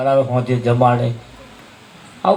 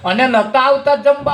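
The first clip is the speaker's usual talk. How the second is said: put-on boy explaining; 10 semitones higher than usual. Son explains to father.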